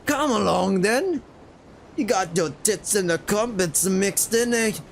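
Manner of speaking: hammed-up British accent, pitched high; old British accent. Shown as a demonstration. Player mocking their own sailor voice, hammed-up British accent. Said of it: "now I sound like an old British folk".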